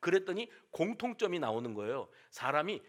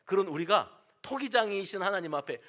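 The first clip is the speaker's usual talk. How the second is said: ordinary talk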